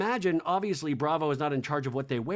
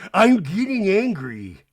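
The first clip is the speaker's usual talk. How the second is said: loud, slow, higher mock warning; mock angry; 4 semitones higher, 8 dB louder, slower than usual. Housewife warning the others, slow loud mock anger. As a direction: imitate someone losing their temper, drawn out. Imitating Meredith's angry outburst.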